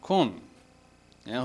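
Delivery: ordinary talk, nothing marked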